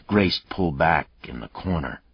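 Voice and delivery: ordinary delivery, nothing marked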